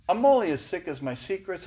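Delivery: ordinary talk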